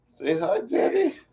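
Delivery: put-on baby talk for the puppy. Girlfriend speaks for the puppy to Fonzo, high baby talk.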